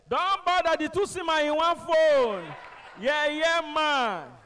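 high, loud, slow taunting shout; loud retort. Retold or imitated. Caller mocking the driver's second line, loud taunt. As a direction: shout back into the phone. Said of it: "I said".

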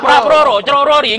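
comic failed attempt at doubling the name "Prabowo"; garbled name. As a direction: mangle "Prabowo" into "Pra Proro, Cerorori". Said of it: "Kalau Pak Prabowo nggak bisa".

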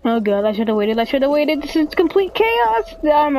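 building game-time fluster; rising panic. Real, not acted. Panicking as game goes wrong.

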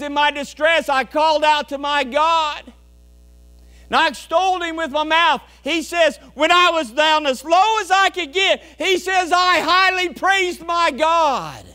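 loud, high exultant preaching; rising ringing praise; preaching psalmist. Acted. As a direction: proclaim the psalm with fire.

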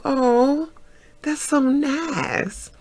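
high, soft, stretched-out politeness; polite sweetness. Retold or imitated. Answering his party invitation, polite sweetness. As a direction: coo it slowly and softly.

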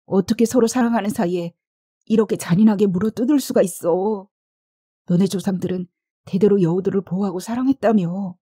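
distraught, rising lament; anguished outburst. A narrator's reading. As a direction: rail in grief at a senseless loss. Acted outburst over dying lover.

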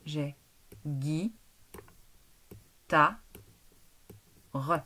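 detached robotic syllable chant. Shown as a demonstration. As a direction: split into syllables like a robot, one beat each. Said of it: "Si je le coupe en voix du robot".

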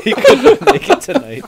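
talking through loud, high laughter; laughing. Real, not acted. Host correcting his own RIP joke, laughing hard. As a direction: crack up while explaining.

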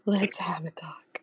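slow, hushed mock-serious whisper; quiet word in private, playfully grave; whispered talking-to. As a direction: whisper it slowly, like a playful telling-off.

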